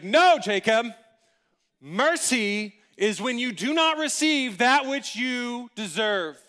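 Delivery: loud, high, slow declaration; emphatic teacher. Retold or imitated. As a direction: proclaim the definition grandly to the pupil. Teacher emphatically corrects and defines mercy.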